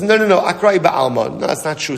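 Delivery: high-pitched, insistent mock protest; protesting denial. Bilam denying his donkey's accusation, protesting. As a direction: jump in high to deny the charge.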